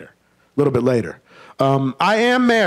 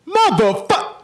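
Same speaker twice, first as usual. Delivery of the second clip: "Motherfuck" said much higher; high-pitched try; 11 semitones higher, slower than usual. Shown as a demonstration. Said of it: "practice how to say motherfucker the right way".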